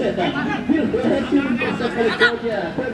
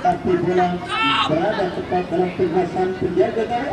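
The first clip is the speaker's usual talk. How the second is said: ordinary delivery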